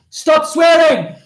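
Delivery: loud, high, drawn-out scolding; mock parental shout; scolding parents. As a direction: imitate parents yelling a telling-off. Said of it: "my parents said".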